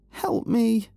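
high, loud panicked scream; screaming for help. Acted. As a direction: shriek in terror for help. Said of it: "she screamed".

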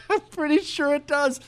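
high, laughing through the words. Real, not acted. Host laughing at his own boast of being accentless, laughing. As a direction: crack up while insisting.

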